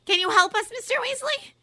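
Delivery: high, loud desperate plea; bursting out. A narrator's reading. Umbridge bursting out to Ron for help, high and loud. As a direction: blurt it out in a rush. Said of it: "she burst out".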